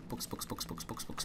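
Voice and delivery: rapid whispered repeating; whispering. Real, not acted. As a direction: whisper it greedily under breath. Grabbing many books while looting.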